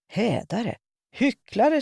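rising, screamed insults; furious shout. A narrator's reading. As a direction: hurl the words at him. Priest and lady trade insults.

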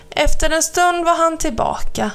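plain reading voice

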